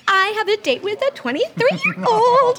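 imitating a giddy friend, high; high excited mock voice. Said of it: "you're like".

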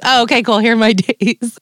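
giggling with relief at a harmless call; relieved laugh. Retold or imitated. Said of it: "You're, like, panicking".

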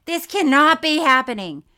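high, loud mock despair; retold outburst. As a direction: repeat it escalated into a loud protest. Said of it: "because I was like".

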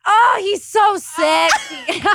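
high, loud, drawn-out swoon with a laugh; gushing swoon. Retold or imitated. Woman gushes to her team about her dream guy, swooning. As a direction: swoon loudly, stretching it out.

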